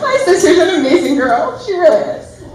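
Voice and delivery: high, tear-choked praise; tearful voice. Real, not acted. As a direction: fight back tears while praising your sister. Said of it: "my voice sounds".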